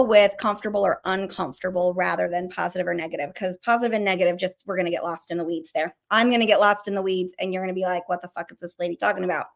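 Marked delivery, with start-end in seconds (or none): 3.3-9.5 rapid, rushed run of words; talking fast. Real, not acted. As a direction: rattle it off quickly without pausing. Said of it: "I am talking so fast today".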